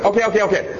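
high, hurried okays; placating kid. Acted. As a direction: fob mom off with a string of okays. Acted child placating scolding mother.

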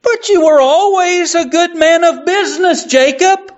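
put-on high, hesitant old miser; faltering Scrooge. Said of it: "faltered Scrooge".

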